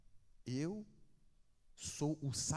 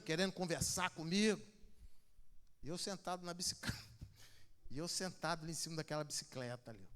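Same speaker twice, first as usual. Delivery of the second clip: usual speaking voice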